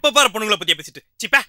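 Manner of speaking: fast, high-pitched telling-off; disgusted scolding. Acted. Friend fed up with girl talk, disgusted scolding. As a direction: scold him in a quick, high burst.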